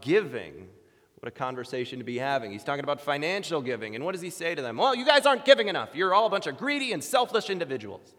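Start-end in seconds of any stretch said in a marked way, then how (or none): 4.8-8.1 loud, high, hammed-up accusation; mock scolding. Paul supposedly berating the church over giving, mock scolding. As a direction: tell them off in pretend outrage.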